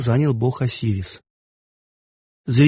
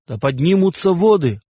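higher-pitched, understated divine decree; exclaimed command; 6 semitones higher than usual. A narrator's reading. Osiris commanding the Nile to rise, mildly exclaimed decree. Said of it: "воскликнул Осирис".

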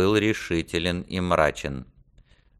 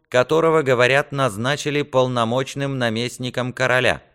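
higher, firm, earnest declaration; a hint of solemn defiance, point by point; lightly voiced resolute squire; 6 semitones higher than usual. Squire states his terms to his lord, firmly resolute.

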